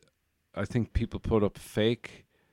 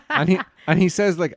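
laughing; 10 semitones higher, 8 dB louder than usual. Real, not acted. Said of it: "laughs through the setup".

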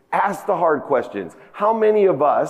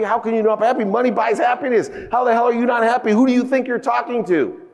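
indignant outburst; 9 semitones higher than usual. Retold or imitated. Husband yelling back at his wife when she says she is leaving, furious. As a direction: climb into outrage with every line.

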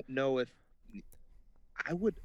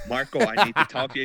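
louder, fast, overly eager plea; mock suspicious urgency; 2 semitones higher, 9 dB louder, faster than usual. Imitating Naomi urging Marco to talk, a loud fast sus plea. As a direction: sound obviously up to something.

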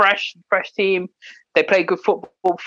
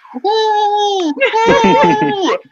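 muffled high cheer through a mask; behind welding mask; 14 semitones higher, 3 dB louder than usual. Retold or imitated. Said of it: "it just sounds like this".

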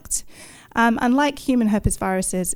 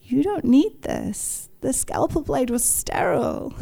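a small, distant voice of reason in her head; the quiet voice from the back of the brain; little inner voice; 3 semitones higher, 4 dB quieter than usual. Retold or imitated. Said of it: "a little voice says".

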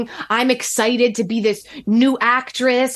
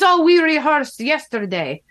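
put-on Russian accent; 5 semitones higher than usual. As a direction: brush it off in his Russian accent. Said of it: "had a very heavy Russian accent".

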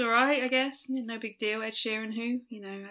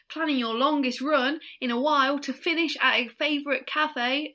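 put-on higher, botched New Zealand accent; New Zealand accent slipping Cockney; 4 semitones higher than usual. Co-host reading a listener's tweet, New Zealand accent gone Cockney. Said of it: "it's going a bit cockney now".

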